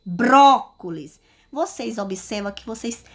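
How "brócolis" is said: Brócolis with a loud, long BRÓ; stress on BRÓ. Shown as a demonstration. Teacher proving the tonic syllable, BRÓ forcefully stressed in Brócolis. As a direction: punch the first syllable of Brócolis, slowly. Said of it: "porque eu falo com mais força".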